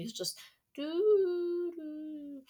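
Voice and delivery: high, slow hummed alarm melody; gentle alarm tune. Retold or imitated. Mocking Android's gentle alarm, high slow hum. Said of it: "gentle breeze music".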